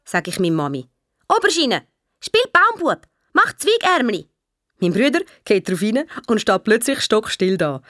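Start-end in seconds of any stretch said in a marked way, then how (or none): 1.3-4.3 high, singled-out commands to a little brother; calling out playfully. Acted. Sister gets brother to play tree, high calling. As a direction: call each instruction high and clear.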